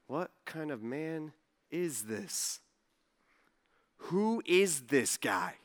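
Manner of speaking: slow, low, soft wondering aside; hushed awe. Acted. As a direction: murmur it slowly to the man beside you. Stunned disciples elbowing each other.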